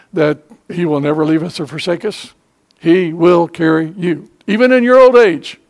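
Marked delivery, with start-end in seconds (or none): 4.5-5.6 put-on high, loud stress on "old"; preaching hard. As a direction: lift it up and call it out to the elderly. Pastor urging old listeners.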